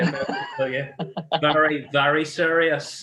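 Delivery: lightly put-on Northern Irish, teasing the guest; awful Northern Irish accent. Host teasing guest about serious accent, in mock Northern Irish. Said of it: "that was my awful Northern Irish accent".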